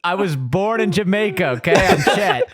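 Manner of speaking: put-on Jamaican accent, laughing. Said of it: "there's already a [name] reference".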